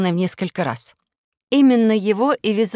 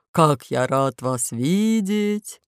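wolf voice; 2 semitones lower, much slower than usual. Acted. Wolf voice in story.

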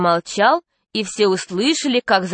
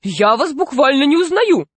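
raised pitch, taken aback; shocked exclamation; 5 semitones higher than usual. A narrator's reading. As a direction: exclaim in indignant disbelief. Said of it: "воскликнула Мария Павловна".

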